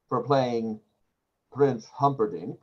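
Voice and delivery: normal talking voice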